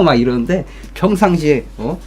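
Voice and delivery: ordinary delivery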